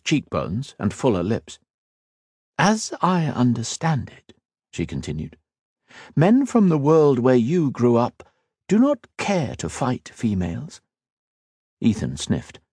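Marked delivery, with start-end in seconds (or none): 2.6-4.3 higher, slow feminine voice. Acted. The Rakasa in elf form addresses Ethan, higher feminine voice. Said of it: "taking on a distinctly feminine timbre". 6.2-10.8 a woman's voice, lifted and teasing; feminine voice. Acted. The elf woman goads Ethan about fighting females, higher feminine voice. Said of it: "taking on a distinctly feminine timbre".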